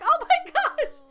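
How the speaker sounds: excited squeal. Real, not acted. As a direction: squeal it like it melts your heart. Gushing over the sweet scene.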